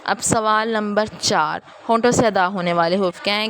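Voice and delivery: usual speaking voice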